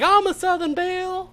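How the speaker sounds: very high Southern-belle voice. Retold or imitated. Friend imitates Brittany doing accents, high Southern belle. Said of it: "Brittany's sitting there like".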